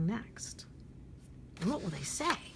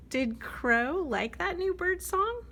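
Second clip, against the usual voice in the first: ordinary delivery, nothing marked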